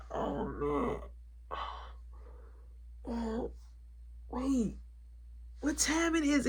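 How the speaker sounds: soft groggy mumble, half awake; groggy just woke. Retold or imitated. Worker just woken by boss, softly groggy and confused. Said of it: "He like".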